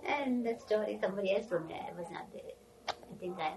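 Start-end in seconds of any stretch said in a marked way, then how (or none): 0.0-1.3 high, giggling through the words; laughing. Real, not acted. Storyteller laughing at the funny story. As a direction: laugh it out, amused.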